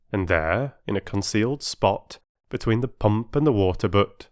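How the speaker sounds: usual speaking voice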